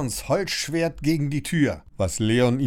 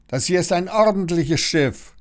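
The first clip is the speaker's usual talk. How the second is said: higher, firm telling-off; mild thundering scolding; 7 semitones higher, 3 dB louder than usual. A narrator's reading. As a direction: lay down the rules aboard. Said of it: "donnert sie".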